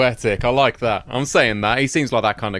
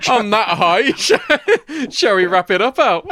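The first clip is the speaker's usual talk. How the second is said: laughing through the words, pitched high and louder; 10 semitones higher, 4 dB louder, slower than usual. Real, not acted. Cohost laughing at a depressing ending, laughing higher and louder. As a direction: giggle out the sign-off suggestion.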